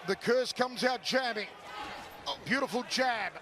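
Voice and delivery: usual speaking voice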